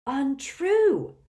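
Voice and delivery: put-on high, wheezy old man's protest; wheezing. A narrator's reading. Doge denying the gossip, wheezing indignantly. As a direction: push it out as a loud wheeze. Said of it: "wheezed Doge".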